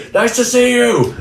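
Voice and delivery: high bright mock hello; mock friendly greeting. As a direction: mimic a cheery wave hello. Imitating a friendly Philly greeting.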